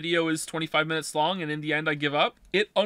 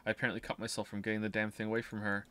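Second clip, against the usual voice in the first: story narration; 9 semitones lower, 10 dB quieter, faster than usual. Acted. Reads game narration aloud.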